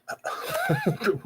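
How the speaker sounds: a sudden squeaky laugh, very high; high burst of laughter. Retold or imitated. Laughing in disbelief at a twenty-year-old's answer. His shocked reaction to her age.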